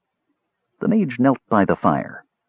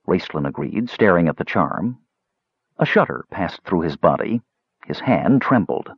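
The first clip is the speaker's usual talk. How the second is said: ordinary delivery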